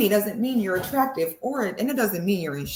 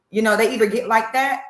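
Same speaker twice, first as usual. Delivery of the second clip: normal talking voice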